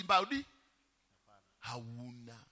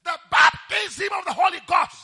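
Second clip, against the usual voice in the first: shouted, slow and much higher; preaching at full force; 9 semitones higher, 18 dB louder than usual. Real, not acted. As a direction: thunder it out to the congregation.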